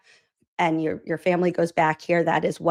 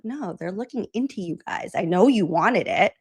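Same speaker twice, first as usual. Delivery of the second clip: ordinary delivery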